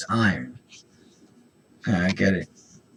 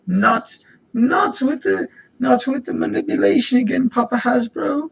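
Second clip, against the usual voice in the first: much higher, louder whining voice; mock whine; 5 dB louder than usual. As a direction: whine like a fed-up kid.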